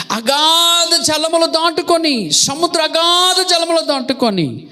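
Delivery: high, declamatory sermon voice; high-pitched pulpit crescendo; impassioned preaching. Real, not acted. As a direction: proclaim it with long drawn-out words. Preacher at full rhetorical pitch.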